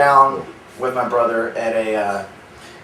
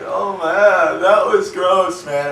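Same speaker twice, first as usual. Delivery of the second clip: drawn-out, higher-pitched mock disgust; disgusted heckler; 7 semitones higher, 3 dB louder, much slower than usual. Audience member reacting to his crude joke, mock disgusted. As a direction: imitate a grossed-out guy, dragging the words.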